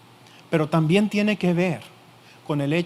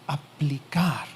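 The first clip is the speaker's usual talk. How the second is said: sudden whisper; 3 semitones lower, 4 dB quieter, much slower than usual. Acted. Preacher stresses applying the word.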